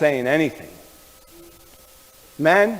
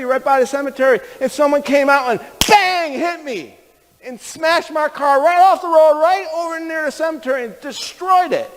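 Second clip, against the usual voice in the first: urgent, hurried excitement; 12 semitones higher than usual. Retold or imitated. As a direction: pour out the mishap at a high pitch. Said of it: "so we're talking real fast".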